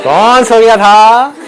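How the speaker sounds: loud, high, drawn-out question; playful calling out. Real, not acted. Speaker teasing the audience about sleeping, playful calling out. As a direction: stretch it out and call to the room.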